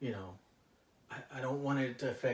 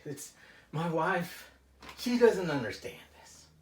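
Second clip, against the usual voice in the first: frustrated venting; 6 semitones higher, 8 dB louder than usual. Acted. Client vents growing frustration.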